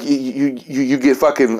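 ordinary talk, nothing marked

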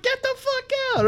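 very high-pitched mock amazement; astonished disbelief. Storyteller learns of the Marine coincidence, astonished. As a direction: squeal it in gleeful disbelief.

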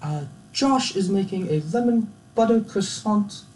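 high, halting posh British presenter; hesitant, badly faked British accent. Baking show host presenting a dish, terrible British accent. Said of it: "my British accent is terrible".

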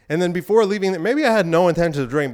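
ordinary talk

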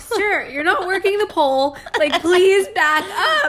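loud, high imagined rebuke; playing fed up, big and loud; exasperated mock telling-off. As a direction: tell them off, drawing out the plea. Voicing an unsaid rebuke to stranger.

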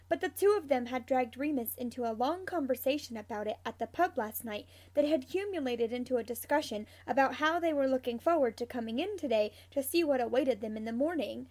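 ordinary delivery

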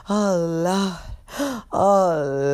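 winded, stretched-out groan; catching breath. Acted. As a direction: heave it out like an exhausted old woman. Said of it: "catch her fucking breath".

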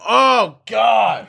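high, loud cry of mock revulsion; mock disgusted outburst. Imitating a random fart victim, loudly revolted. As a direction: yell it like a bystander hit by a stench. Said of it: "or just, like, the random".

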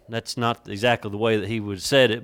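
ordinary talk, nothing marked

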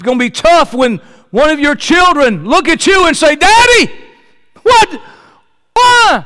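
very high, loud preacher's wail; anguished shout. Acted. Preacher acting out a child asking Daddy why, anguished shouting. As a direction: explode into a child's desperate cry.

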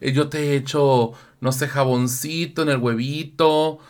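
tender, soft lover's voice; soft cooing. Retold or imitated. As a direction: coo it softly to a lover. Voicing lover in shower. (arrullo suave de pareja)

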